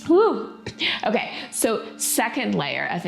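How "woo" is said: high, loud happy whoop; excited whoop. Real, not acted. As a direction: let out a joyful cheer. Cheer after a lion's breath round.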